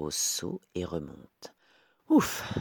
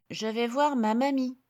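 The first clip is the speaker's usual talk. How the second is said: put-on high, bright little girl; 6 dB louder than usual. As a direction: play the small girl. Girl answers wolf.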